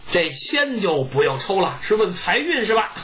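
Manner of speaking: ordinary delivery, nothing marked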